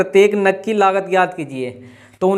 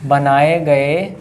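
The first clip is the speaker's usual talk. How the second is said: बनाए गए drawn right out, lower; slow dictation; 4 semitones lower, much slower than usual. Real, not acted. Teacher repeats for students writing, slow drawn-out dictation. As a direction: stretch बनाए गए very slowly for writers.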